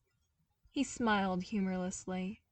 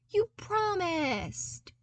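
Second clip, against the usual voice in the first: slow, much higher whiny plea; playful whine; 11 semitones higher, 4 dB louder, much slower than usual. Acted. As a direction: drag it out like a kid. Luke keeps whining playfully.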